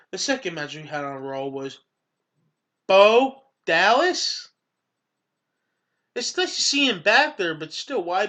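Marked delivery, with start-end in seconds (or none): 2.9-4.5 loud, slow ring-announcer call. Acted. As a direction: stretch the name out like an announcer.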